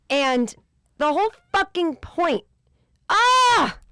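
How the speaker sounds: frustrated scream. Real, not acted. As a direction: let it burst out of you. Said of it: "mad at myself".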